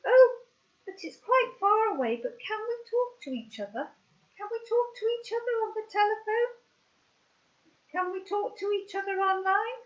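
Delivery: very high, excited little monkey voice; high, quick puppet asking to keep in touch; squeaky puppet voice. Pretend. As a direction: squeak it out like an eager toy monkey. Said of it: "or they go".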